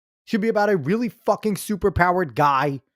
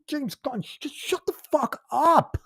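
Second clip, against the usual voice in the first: much higher, strained, pained whine; exasperated whine; 11 semitones higher, 3 dB quieter, slower than usual. Real, not acted. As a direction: plead in exasperation, almost crying. Angry rant at director.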